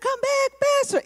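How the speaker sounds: high-pitched mock scream for the pastor; imitated screaming. Girls calling their taken pastor back, imitated screaming. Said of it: "so they start screaming".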